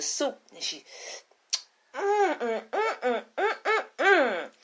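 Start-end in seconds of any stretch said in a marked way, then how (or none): 1.9-4.5 savoring mmm. Acted. Queen tastes the soup.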